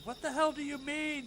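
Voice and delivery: high, loud outraged yell; scream. Acted. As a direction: shout it at the voice in his head, furious. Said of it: "Finthic would scream".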